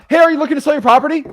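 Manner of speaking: high, loud, quick cold-call opener; mock cold caller. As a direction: blurt out a pushy sales opener.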